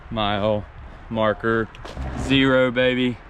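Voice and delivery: loud victory shout; loud triumphant shout at the finish line. Real, not acted. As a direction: call it out like you won. Finishing the twenty-three mile hike.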